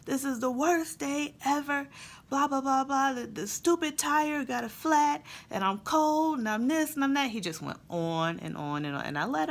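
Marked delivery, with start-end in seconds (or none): 0.0-7.3 put-on much higher, whiny kid's rant; son ranting. Son ranting to mom on the porch, high whiny complaint. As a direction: whine it like a cold, fed-up boy. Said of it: "the ranting just began".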